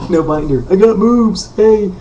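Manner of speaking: playful brag. Pretend. Bragging to the camera about his new moves, high and playful. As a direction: crow it like a proud little dance.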